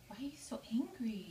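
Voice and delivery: soft unvoiced whisper to the bird. Real, not acted. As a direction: hush it right down, breath only. Talking softly to her bird.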